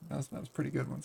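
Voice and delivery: ordinary talk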